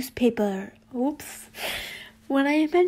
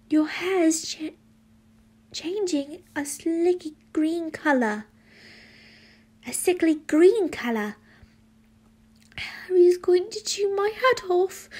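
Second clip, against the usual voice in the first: higher voice, stretching the words; excited teen character; 6 semitones higher than usual. Acted. Friend frets about Harry's reaction, high-pitched character voice. Boy character's worried dialogue.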